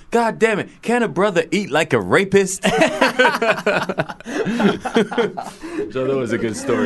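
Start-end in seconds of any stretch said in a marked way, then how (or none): 0.1-2.6 much higher, louder laughing rant; imitating Chris Rock. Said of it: "he goes". 2.8-5.9 high burst of laughter; real laughter; loud laugh. Real, not acted. Laughing at own story.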